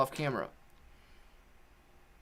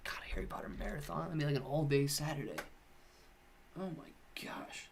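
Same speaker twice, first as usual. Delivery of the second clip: soft breathy whisper, much softer; whispered aside; 15 dB quieter than usual. Real, not acted. Dismayed at the marathon length, whispered aside. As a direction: murmur it away from the mic.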